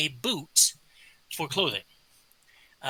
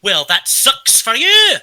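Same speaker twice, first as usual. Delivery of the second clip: slow, loud, high mocking bartender voice; smug barkeep; 7 semitones higher, 14 dB louder, slower than usual. Barkeep to the player who reads one language, slow and smug. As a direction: drag it out, rubbing it in.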